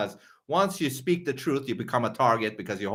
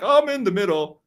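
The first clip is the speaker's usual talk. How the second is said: higher put-on voice of a fence-sitter; mock fence-sitter; 7 semitones higher, 4 dB louder than usual. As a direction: mimic the middle-grounder, pitched up. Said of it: "is to be like".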